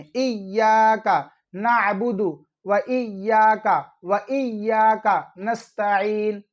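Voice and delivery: slow इय्याका, settling on the doubled y; measured model reading of इय्याका with stretched "yaa"; firmly held sounds. Shown as a demonstration. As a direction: hold each इय्याका long, ain in नस्तईन. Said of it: "यहाँ पर जमा के पढ़ा करें".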